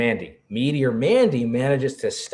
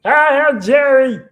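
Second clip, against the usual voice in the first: high, loud, drawn-out groan of a name; a loud, slow, fed-up wail of "Jerry"; exasperated cry; 10 dB louder, much slower than usual. Pretend. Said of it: "it's just like".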